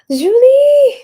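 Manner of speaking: high, loud delighted gasp then calls out Julie; excited gasp and call. Real, not acted. Greeting a viewer arriving live, gasps and cries out the name.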